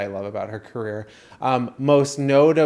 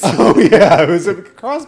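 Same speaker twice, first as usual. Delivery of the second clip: loud laugh into oh yeah; 7 semitones higher, 12 dB louder than usual. Real, not acted. Cohost laughing loudly at the screech story. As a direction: burst out laughing.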